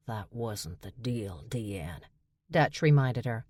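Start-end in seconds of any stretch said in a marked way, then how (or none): 0.1-2.0 low, soft and slow; quiet pointed reminder; hushed, deliberate warning. A narrator's reading. Dutch reminding her, low.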